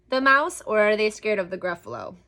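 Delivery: plain reading voice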